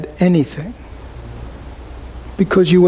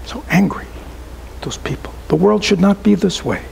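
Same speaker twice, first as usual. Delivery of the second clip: half-whispered, grumbling mock complaint; muttering angry complaint; 4 dB quieter than usual. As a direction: imitate someone muttering resentfully under their breath. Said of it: "People go".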